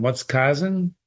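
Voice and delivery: ordinary delivery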